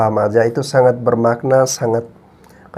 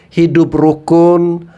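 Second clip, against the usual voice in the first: normal talking voice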